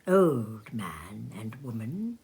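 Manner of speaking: hushed, slow and tender. A narrator's reading. Tender image of old couple.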